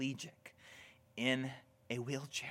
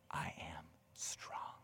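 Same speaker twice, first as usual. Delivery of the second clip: hushed whisper; 8 semitones lower, 14 dB quieter, slower than usual. Acted. Finishing Paul's quote for congregation.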